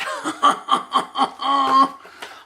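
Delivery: high, drawn-out cackle; long string of high ha-ha-has; laughing. Real, not acted. Storyteller cracking up at his bear joke, high cackle.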